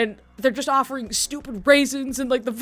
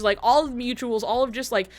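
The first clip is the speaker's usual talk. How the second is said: ordinary talk, nothing marked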